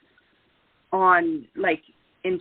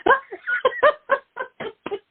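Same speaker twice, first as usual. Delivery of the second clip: high burst of laughter. Real, not acted. Laughing at funny story.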